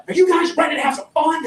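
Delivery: mock hype from a guide; pumped-up tour guide. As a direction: rev up the crowd like a tour guide. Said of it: "tour guide to pump you up".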